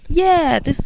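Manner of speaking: high, bright-voiced Aussie impression; fake Aussie accent. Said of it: "my fake Aussie accent".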